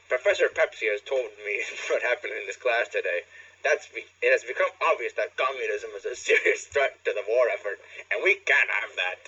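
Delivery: loud, pompous headmaster; self-satisfied bourgeois speechifying; smug bourgeois voice. Acted. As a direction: lecture the hall smugly. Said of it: "he said in a smug bourgeois voice".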